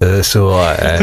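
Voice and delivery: low-pitched, understated approval; mellow mock approval. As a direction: act out a casual, low verdict after a sniff. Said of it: "Just like that".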